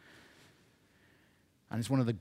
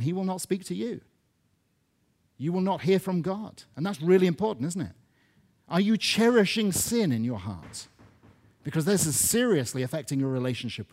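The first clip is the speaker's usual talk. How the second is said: higher and louder, pressing the point; rising preacher's challenge; 6 semitones higher, 5 dB louder than usual. Acted. Preacher challenging the church about cherished sin, forceful. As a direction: challenge the listeners head on.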